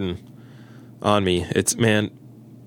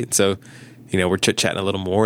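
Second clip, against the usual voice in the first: normal talking voice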